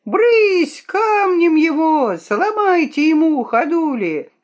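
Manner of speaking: loud, high-pitched mob yell; drawn-out squealing jeers of pestering urchins; shrill jeering. A narrator's reading. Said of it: "визгливой, надоедливой стаей". (визгливые крики задиристой толпы)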